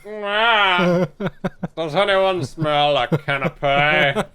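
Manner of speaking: slow, drawling Alan Rickman voice; lofty Alan Rickman villain drawl; Alan Rickman impression. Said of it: "that was more Alan Rickman".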